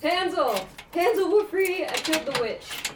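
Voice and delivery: high, loud joyful calls; excited shouting. Acted. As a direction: cheer to your brother. Celebrating freedom.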